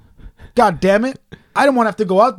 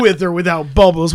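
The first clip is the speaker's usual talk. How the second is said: mock-offended echo, a touch louder; mock outrage; 5 dB louder than usual. Echoing the friend's bath question with mock offence. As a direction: throw the question back as if insulted. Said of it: "What am I, an animal?".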